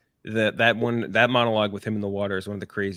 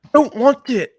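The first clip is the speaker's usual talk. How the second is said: much higher and louder strained voice; mock Jon Snow; 11 dB louder than usual. Co-host joining the Jon Snow bit, hammed-up mock brooding voice. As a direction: ham up a brooding hero's refusal. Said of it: "the Jon Snow voice".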